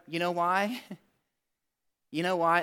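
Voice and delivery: ordinary talk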